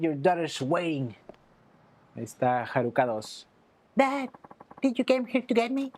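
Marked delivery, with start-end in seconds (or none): 4.0-5.9 put-on higher girl's voice, relieved; daughter voice. Daughter asking her rescuing father, higher girl voice.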